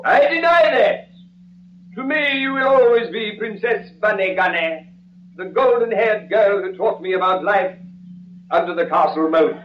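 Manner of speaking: high impassioned outburst, easing down; hammy romantic declaration; stage melodrama. Acted. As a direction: protest grandly, then wax sentimental. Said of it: "on with the scene".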